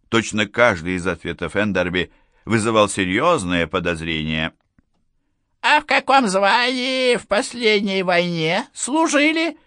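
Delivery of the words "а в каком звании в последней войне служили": put-on high, pushy old general; stern general. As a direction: press him like a suspicious old officer. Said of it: "спросил он, нанося последний удар". (напористый голос генерала)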